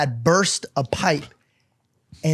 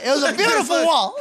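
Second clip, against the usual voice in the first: laughing high through the words; can barely get it out for laughing; giggling at the memory; 11 semitones higher, faster than usual. Real, not acted. Laughing at own story.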